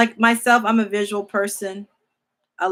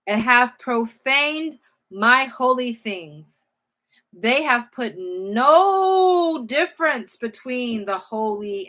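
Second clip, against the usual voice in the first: slow, louder solemn reading of the verse; scripture reading; 2 semitones higher, 6 dB louder, slower than usual. Acted. Preacher reading Ezekiel to the congregation, slow and solemn. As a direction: read it out slowly, weighing each line.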